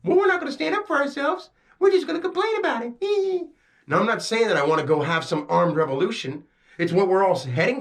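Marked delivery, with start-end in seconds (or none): 0.0-3.5 high mocking whine of a complaining child; whiny kid voice. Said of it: "And you go".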